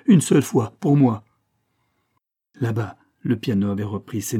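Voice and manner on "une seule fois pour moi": louder, higher last appeal; urgent, imploring finish; supplication. A narrator's reading. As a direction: beg for a single favour, emphatically. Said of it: "les mains levées en guise de supplication".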